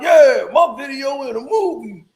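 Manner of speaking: loud, hammed-up boast, chest puffed out; mock bragging shout. Mocking a braggart he refuses to be, loud mock boasting. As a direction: shout it like a show-off who won't shut up. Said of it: "Do I walk around twenty-four hours a day with my chest out?".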